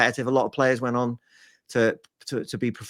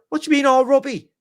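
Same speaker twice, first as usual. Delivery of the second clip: higher and louder astonished disbelief; 10 semitones higher, 6 dB louder than usual. Retold or imitated. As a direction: shout it in shocked disbelief. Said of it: "I was like".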